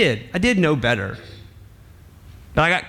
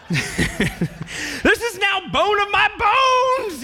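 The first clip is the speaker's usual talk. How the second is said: much higher, slow, laughing exclamation; overjoyed Adam; much slower than usual. Acted. Adam sees the woman and gushes with laughing joy. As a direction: stretch out the words in giddy delight.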